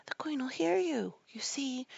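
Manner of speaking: high, breath-led warning; frightened whisper. A narrator's reading. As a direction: whisper fearfully that someone may hear. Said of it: "whispered in a frightened tone".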